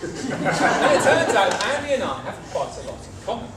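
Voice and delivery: normal talking voice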